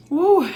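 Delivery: sharp high "woo" of relief; high loud whoop. Real, not acted. Reacting after eating hot food.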